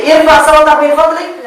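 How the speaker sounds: high and loud, acting out the meaning. Shown as a demonstration. Teacher showing the class what raise your voice means, loud and high. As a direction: lift the voice high and call it out. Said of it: "Tinggikan suaramu dari".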